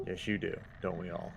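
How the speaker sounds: low, softly trailing southern voice; southern accent. Acted. Player agrees with troubled guard, low southern accent. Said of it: "That was all said in a southern accent".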